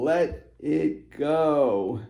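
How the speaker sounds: very slow, drawn out. Real, not acted. Reader to viewer, slow drawn-out mantra. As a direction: stretch every word of "Let it go" slowly. Repeats key message, drawn out.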